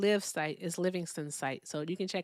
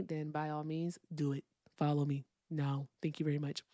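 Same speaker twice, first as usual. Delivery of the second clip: deep voice; 3 semitones lower, 7 dB quieter than usual. Pretend. Streamer urging viewers to follow, in a softer deep voice. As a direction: drop into a lower, softer put-on deep voice. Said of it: "that's my deep voice".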